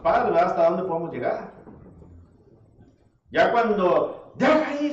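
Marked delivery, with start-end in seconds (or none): 4.3-4.9 high, raised imitation of a parent yelling; a parent's shouted command, much higher; mock yell. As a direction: yell the order like a fed-up parent. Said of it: "se oye el grito".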